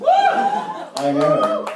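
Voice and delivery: high squeaky "I know" with a laugh; mock vanity squeal. Comic mock-preening about his looks, squeaky and laughing.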